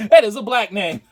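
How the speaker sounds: high, loud laughter through words; cracking up at his own joke; laughing. Real, not acted. Joke about naming a child.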